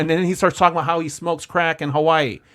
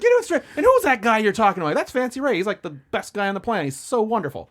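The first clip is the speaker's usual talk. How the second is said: higher, lightly gushing praise; gushing about Fancy; 7 semitones higher than usual. Retold or imitated. Son defending Fancy to dad, higher gushing praise. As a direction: gush like a fan, pitched up. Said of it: "It's like".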